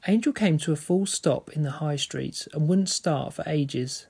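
steady read-aloud storytelling voice; story narration. A narrator's reading. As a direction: read the novel to the listeners. Reads a novel aloud.

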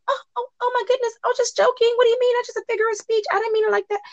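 very high, quick mock-innocent squeal; faking madness. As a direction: ham up a panicked "just joking" backtrack. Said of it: "that's when she wanna".

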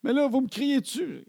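high-pitched accusing whine, imitated; mock an outraged teenager's protest; teen protest. Daughter accuses calm parents of yelling, indignant teen protest. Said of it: "là, elle dit".

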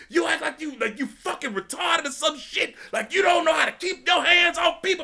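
high, fast, angry yelling; furious coach. Retold or imitated. Coach cussing the kid out, high angry yell. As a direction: chew the kid out at full volume.

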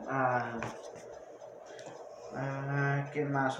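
normal talking voice

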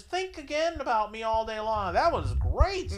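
lightly voiced satisfied lady; pleased girlfriend.

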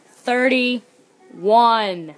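loud and close, right in the ear; right in your ear. Pretend. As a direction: say it straight into their ear. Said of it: "whisper in your ear".

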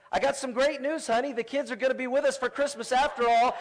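high, bright, laughing punchline; gleeful dad. Retold or imitated. Father gloating to his wife that the trick worked, laughing. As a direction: crow it with a triumphant chuckle.

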